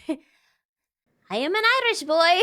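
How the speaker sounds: playing an Irish boy, a hint of an Irish accent, slow and high. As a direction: announce yourself as an Irish lad, slow. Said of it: "narrate an Irish boy".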